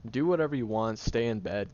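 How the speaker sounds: fed-up snap. Acted. Giving up on the argument.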